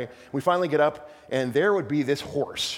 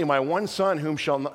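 normal talking voice

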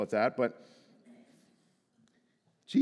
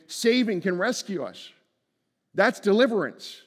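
higher, louder pulpit declaration; fervent sermon climax; preaching at full force; 7 semitones higher, 6 dB louder than usual. Acted. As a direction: proclaim it with conviction. Preacher builds again.

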